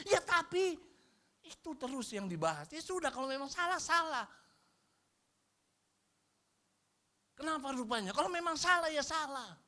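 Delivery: high, loud preaching; fiery preaching. Acted. Pastor urges the congregation to own mistakes, fiery and high. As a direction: drive the point home.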